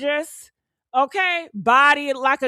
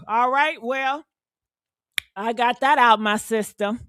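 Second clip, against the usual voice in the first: usual speaking voice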